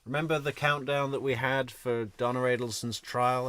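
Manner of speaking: normal talking voice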